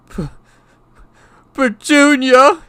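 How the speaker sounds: shocked, high, loud gasped name; stammered gasp. A narrator's reading. Uncle shocked by the letter, stammering gasp. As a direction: choke on the first sound, then cry out. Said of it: "he gasped".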